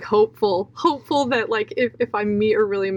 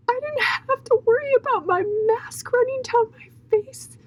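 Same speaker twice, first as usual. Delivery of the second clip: high, squeezed tearful voice; weeping with relief, voice pitched very high; real crying. Real, not acted. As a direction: push the words out through tears, with long pauses. Weeping as she tells of freedom.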